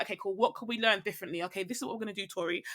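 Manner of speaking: quiet, gentle words to her younger self; hushed reassurance to her seven-year-old self; soft self-talk. Retold or imitated. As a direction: talk softly to your child self.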